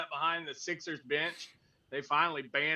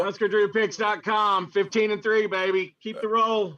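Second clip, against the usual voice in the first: loud, high, slow hype plug; hype announcer; 6 semitones higher, 7 dB louder, slower than usual. Real, not acted. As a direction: hype up your record. Announcing his own picks record.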